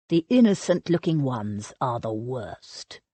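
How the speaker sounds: a low, dark murmur. A narrator's reading. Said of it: "He added darkly".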